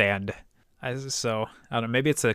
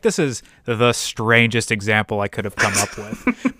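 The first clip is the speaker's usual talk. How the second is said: ordinary talk, nothing marked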